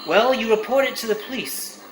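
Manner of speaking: ordinary delivery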